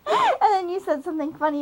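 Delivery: usual speaking voice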